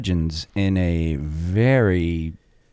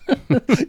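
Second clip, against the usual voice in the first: sharp high burst of laughter. Real, not acted. Laugh at joke.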